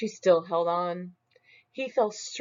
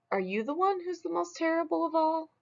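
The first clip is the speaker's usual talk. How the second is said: much higher, quick demand; high fast question; 11 semitones higher, 3 dB quieter, faster than usual. Acted. Smug demands of a beast, high and fast.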